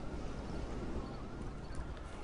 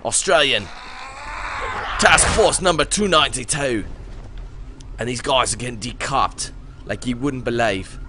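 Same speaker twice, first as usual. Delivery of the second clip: put-on Australian accent. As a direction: proclaim name and rank in mock Australian. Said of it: "How's my Australian accent?".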